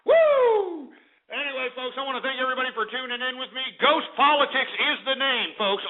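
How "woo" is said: excited whoop. Real, not acted. Host cheers at show end.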